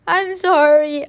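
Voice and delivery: a loud tearful I'm sorry; boy apologizing to mom, tearful and loud; tearful apology. A narrator's reading. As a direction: blurt the apology through tears. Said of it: "I said between sniffles".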